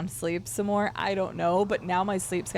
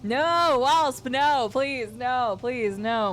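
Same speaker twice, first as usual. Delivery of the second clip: loud, higher no, no, please fading down; mock pleading; 6 semitones higher, 5 dB louder, much slower than usual. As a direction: beg dramatically, then let it fade. Begging the character not to.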